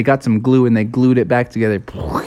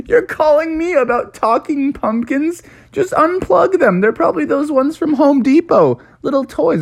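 scoffing police officer; 13 semitones higher than usual. Acted.

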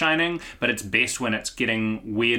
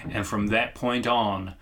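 low grave monologue voice; 5 semitones lower, 6 dB quieter than usual. Retold or imitated. Character monologue retold.

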